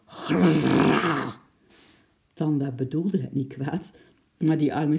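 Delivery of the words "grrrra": loud growling tiger roar; a tiger growling loudly because it is hungry. A narrator's reading. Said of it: "brulde toen hard en luid".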